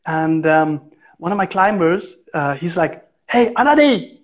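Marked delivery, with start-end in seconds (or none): 3.3-4.2 higher call up the tree to get his attention; imitating a coworker calling up a tree. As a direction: shout "Anadi" up to the climber, falling off the name. Coworker calls up to him.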